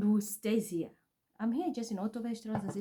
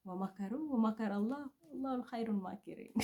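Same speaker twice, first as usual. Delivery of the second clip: rapid recitation; 8 dB quieter, much faster than usual. Real, not acted. Reciting a Quran verse rapidly.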